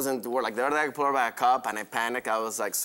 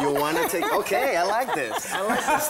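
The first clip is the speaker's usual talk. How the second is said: laughing; 7 semitones higher, 5 dB louder than usual. Real, not acted. Flirting with nutritionist.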